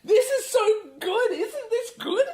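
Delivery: very high, drawn-out squeal of delight; hammed-up high-pitched fan excitement; mock giddy gushing. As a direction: gush like an overexcited fan, laughing through it. Acting out overexcited cinema crowd.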